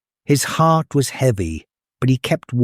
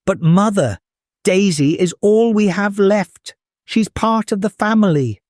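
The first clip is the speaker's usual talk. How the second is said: put-on higher, upset boy's protest; pleading boy; 7 semitones higher than usual. Jack protests in shock.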